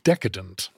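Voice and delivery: "Decadent" as DECK-a-dent, low and soft; modern pronunciation. Shown as a demonstration. Guest echoing the host, low soft "Decadent" as DECK-a-dent. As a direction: quietly try out "Decadent", stressed on DECK. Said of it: "making us say decadent wrong".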